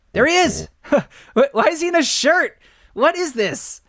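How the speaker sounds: high, loud laughing disbelief; delighted shock; surprised exclaim. Real, not acted. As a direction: blurt it out amazed. Surprised at seeing dad.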